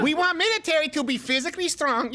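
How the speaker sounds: exaggerated mock Chinese accent. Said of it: "a racist stereotypical accent".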